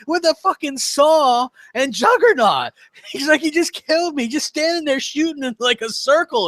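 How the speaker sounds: mock outraged gamer rant; imitating a flipping out friend. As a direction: whine indignantly about a saw kill.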